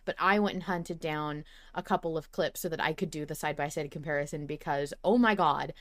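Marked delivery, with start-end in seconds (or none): none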